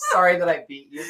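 high, laugh-choked mock apology. Real, not acted. Runner apologizing for beating his rival, laughing. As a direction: say sorry while cracking up.

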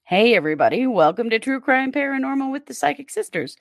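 ordinary talk, nothing marked

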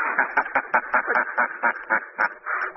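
low whispered cackle; breathy mad laugh. Acted. Madman laughing while he strangles his enemy, whispered cackle.

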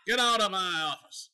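put-on Brian Cox, Southern accent. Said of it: "It's a little Scottish in there".

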